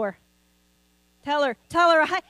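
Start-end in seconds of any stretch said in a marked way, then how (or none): 1.2-1.6 firm raised call to a congregant; urging her to speak up. Real, not acted. Pastor calling across the room, firm and raised. 1.7-2.0 higher, louder call, pressing harder. Real, not acted. Pastor pressing a shy congregant, calling out higher and louder.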